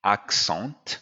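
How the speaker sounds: ACCENT ending in a nasal "sont"; French nasal vowel on the end of ACCENT; French mispronunciation. Shown as a demonstration. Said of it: "The next word which is mispronounced".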